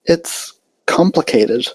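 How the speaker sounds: high, slow and hesitant; uneasy shy pony; Fluttershy voice. A narrator's reading. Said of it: "Fluttershy said".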